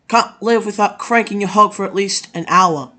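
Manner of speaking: ordinary talk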